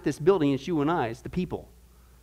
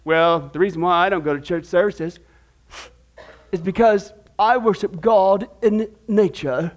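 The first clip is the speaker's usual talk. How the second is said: put-on British accent. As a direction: parody a pious British churchgoer. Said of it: "do that British accent again".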